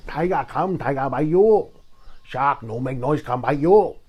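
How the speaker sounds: mock Chinese accent, punching the line endings. Comedian weighing tiger against shark, in a Chinese accent. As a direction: chant it in broken English with a hammy Chinese accent. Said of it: "I can do that Chinese accent".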